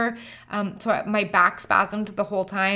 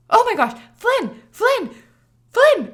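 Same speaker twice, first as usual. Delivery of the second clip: high, loud calling of Flynn; frantic shouted name, rising each time; urgent call; 13 semitones higher, 7 dB louder, slower than usual. Retold or imitated. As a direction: wake your husband in a panic. Waking husband urgently.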